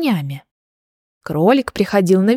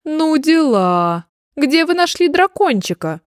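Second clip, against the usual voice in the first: amused amazement; 6 semitones higher than usual. A narrator's reading. Dragon discovers the baby is a dragon, amused amazement. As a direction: marvel merrily, stretching the first words. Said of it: "услышал весёлый смех дракона".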